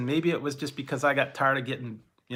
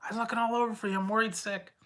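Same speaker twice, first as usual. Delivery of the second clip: worried mom; 9 semitones higher, 3 dB quieter than usual. Retold or imitated. Mother scolding after search.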